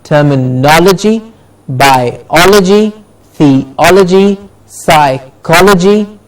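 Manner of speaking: "terminology, biology, theology, psychology" as a drawn-out list, OL stressed in each; teacher's slow model of "terminology, biology, theology, psychology", weight just before logy. Shown as a demonstration. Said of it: "we stress the syllable before logi".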